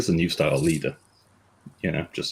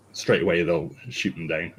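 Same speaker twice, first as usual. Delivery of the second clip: ordinary talk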